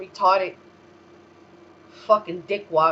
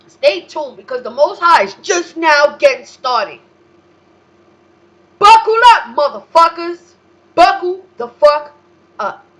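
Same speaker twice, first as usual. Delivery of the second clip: much higher, much louder hype shout; fired-up yell; 8 semitones higher, 12 dB louder than usual. Real, not acted. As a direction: roar the warning like a battle cry. Hyping the audience, threatening.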